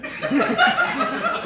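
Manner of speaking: high squeal of laughter. Real, not acted.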